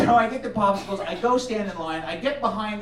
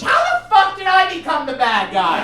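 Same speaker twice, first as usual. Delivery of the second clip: higher, incredulous outburst; indignant protest; 6 semitones higher, 4 dB louder than usual. Retold or imitated. Storyteller after the checkout girl scolds him, indignant outburst. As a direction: protest loudly at being blamed.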